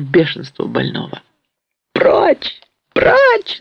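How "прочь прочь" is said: very high, piercing repeated cry; frantic shout. A narrator's reading. Patient breaking free from grandfather, frantic shout. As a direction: cry out wildly to drive them away. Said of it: "закричал он, вырываясь от деда".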